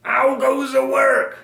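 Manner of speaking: high, loud, drawn-out farmer's call. A narrator's reading. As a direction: call out slowly as the lazy farmer. Farmer's repeated call in story.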